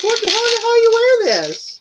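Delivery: high, baffled disbelief; incredulous. Real, not acted. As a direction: ask it like you can't believe it. Baffled at odd earrings.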